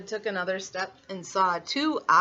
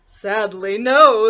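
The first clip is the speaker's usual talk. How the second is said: wolf voice; 10 dB louder, slower than usual. Acted. Wolf declares.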